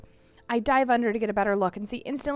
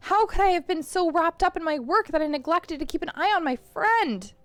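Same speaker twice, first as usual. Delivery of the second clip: usual speaking voice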